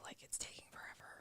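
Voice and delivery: fast, breathy whisper of an impatient thought; whispered mock complaint. Cohost whispering a parent's impatient inner grumble. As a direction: mutter it under your breath in a rush.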